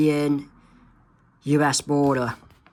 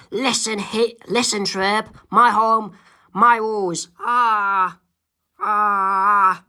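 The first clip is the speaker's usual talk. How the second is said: mock bossy babysitter; 5 semitones higher, slower than usual. Vicky bullying Timmy.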